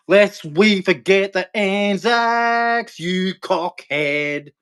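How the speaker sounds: drawn out very slow, each word stretched as a mock war cry; slow battle cry. Ranting Aussie threatening the coppers, slow drawn-out mock war cry. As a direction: drag every word out like a hammed-up battle chant. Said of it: "That's what I'll be saying as I'm punching you".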